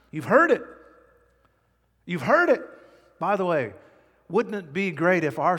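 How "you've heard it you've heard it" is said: You've heard it, louder and higher, punched on heard; emphatic call. Real, not acted. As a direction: drive it home to the congregation. Repeated emphatic declaration.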